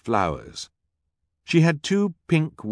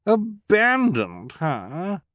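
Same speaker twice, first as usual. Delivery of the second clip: "Abandoned" much higher, slow, rising in disbelief; high, drawn-out "Abandoned her?"; shocked repeat; 11 semitones higher, much slower than usual. A narrator's reading. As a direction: echo "Abandoned" in delighted shock. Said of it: "Esme repeated".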